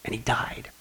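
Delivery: solemn whispered ending; hushed whisper. Acted. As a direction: whisper it, almost no voice. Solemn close of each deathbed story.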